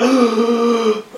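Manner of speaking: long, high mock gasp; mock horrified gasp. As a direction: gasp in pretend shock at something dirty. Acted shock at eating dropped food.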